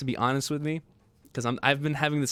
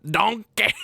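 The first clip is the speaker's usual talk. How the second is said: higher, louder ogre yell; Shrek yelling Donkey; 9 semitones higher, 6 dB louder than usual. Retold or imitated. Streamer quoting Shrek calling Donkey, mock yell. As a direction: call out like Shrek.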